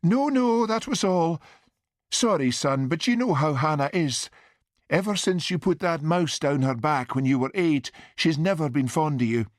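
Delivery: high-pitched, apologetic mother's voice. A narrator's reading. Mother explaining aunt's dislike to son, high apologetic voice. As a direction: excuse yourself to your son as his mother.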